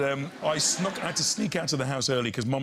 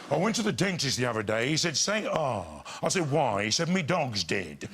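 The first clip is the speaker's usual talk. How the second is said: old-time comic's put-on drawling delivery; 2 semitones lower than usual. Shown as a demonstration. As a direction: tell the gag as a variety-show entertainer. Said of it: "he used to talk like this".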